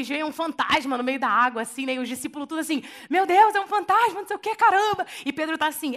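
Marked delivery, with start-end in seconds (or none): 3.1-5.1 higher, louder mock fright; mock panic. Disciples seeing a ghost on the water, mock terrified cry. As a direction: play the scared disciples, hammed up.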